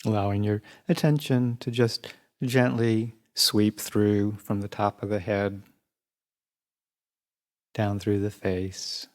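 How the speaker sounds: even, unhurried meditation voice with long pauses; guided meditation. Real, not acted. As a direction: guide listeners calmly through the body, leaving space between phrases.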